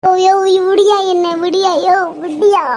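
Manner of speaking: wife crying out under blows; pained cries. Acted. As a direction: cry out while being hit. Wife being beaten cries out.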